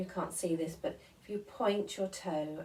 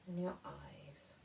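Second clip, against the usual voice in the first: soft whisper, almost no voice; 7 dB quieter than usual. Acted. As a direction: breathe the words out as a whisper. Whispered in body scan.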